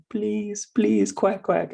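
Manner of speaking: high mock-crying child voice; tearful toddler plea. Son in tears begs for crackers, imitated tearful plea. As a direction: imitate a little one begging through tears. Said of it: "he's crying and his face is covered in tears".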